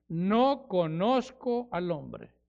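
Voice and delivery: very slow, weighty denial; a touch of Peter's desperate swearing; slow oath. Acted. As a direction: lay each word down slowly.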